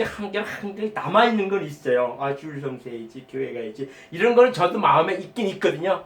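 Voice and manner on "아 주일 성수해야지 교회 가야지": much softer, lower, half to himself; inner murmur. Retold or imitated. A believer quietly reminding himself of Sunday duty, murmured low. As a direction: murmur the old habit under your breath.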